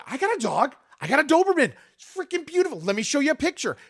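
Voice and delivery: high and loud, gushing about his Doberman; excited dog bragging. Shown as a demonstration. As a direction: show off your dog like a proud owner.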